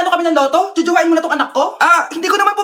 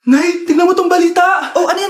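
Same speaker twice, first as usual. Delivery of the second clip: usual speaking voice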